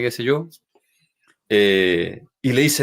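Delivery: ordinary delivery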